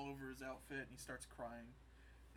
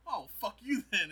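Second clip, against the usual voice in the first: louder, higher brush-off; mock indignant; 7 semitones higher, 11 dB louder than usual. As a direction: play an offended kiss-off, loud and high. Lando snaps at Luke and leaves.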